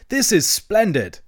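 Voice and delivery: high, drawn-out delight; delighted exclamation. A narrator's reading. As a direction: relish it slowly, pitched right up. Toad delighted to see his friends.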